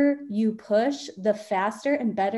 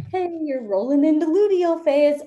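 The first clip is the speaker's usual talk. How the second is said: bright, higher chirpy reminder voice; app notification voice; 7 semitones higher, 6 dB louder than usual. Retold or imitated. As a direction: read it like a cheery phone alert.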